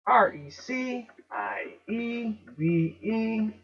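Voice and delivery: slow letter-by-letter spelling; spelled out. Shown as a demonstration. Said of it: "I E became, uh, E I".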